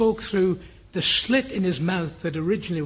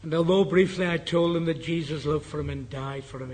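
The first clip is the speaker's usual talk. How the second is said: ordinary talk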